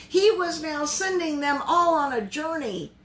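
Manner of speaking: ordinary talk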